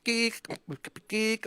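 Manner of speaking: high squeaky bird chirp "geek"; parakeet chatter. Retold or imitated.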